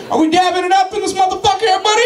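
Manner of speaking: high, laughing shout to the crowd; real hyped call to the audience; crowd hype. Real, not acted. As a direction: pump up the audience, yelling high. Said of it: "hyping the crowd".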